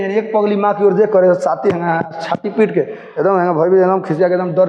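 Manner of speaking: ordinary talk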